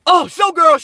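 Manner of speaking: high, loud delighted call-out; light up and shout her name; excited shout. Real, not acted. Greets a loyal viewer in chat with an excited shout.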